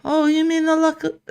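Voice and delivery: put-on lightly done Irish accent. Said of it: "an Irish accent".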